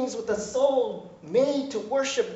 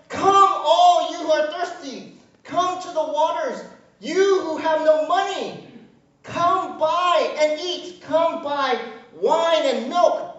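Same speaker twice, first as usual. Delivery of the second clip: proclaiming scripture; 6 semitones higher, 5 dB louder than usual. Acted. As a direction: call it out to the crowd.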